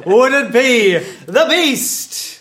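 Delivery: game show host audience poll. Acted.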